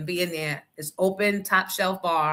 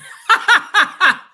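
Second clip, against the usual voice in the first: high cackling laugh; loud burst of laughter; 10 semitones higher, 10 dB louder than usual. Real, not acted. Host cracking up at a comment, loud high laugh. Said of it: "you're so stupid".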